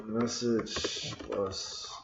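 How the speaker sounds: soft, slow muttering; quiet absent-minded mutter; muttered to self. Real, not acted. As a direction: say it under your breath as you type. Muttering while typing.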